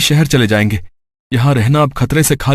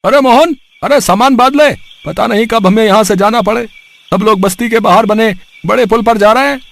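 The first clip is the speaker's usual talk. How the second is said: higher, louder neighbour calling in a hurry; urgent neighbour; 8 semitones higher, 3 dB louder than usual. Acted. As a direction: neighbour calling out to pack up quickly. Neighbour urges Mohan to pack. (पड़ोसी की ऊँची, जल्दबाज़ पुकार)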